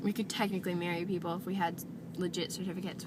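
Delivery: normal talking voice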